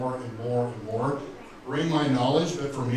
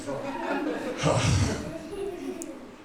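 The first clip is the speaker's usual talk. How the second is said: a high laughing sigh, catching his feelings; emotional sigh. Real, not acted. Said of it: "Oh, I always get emotional".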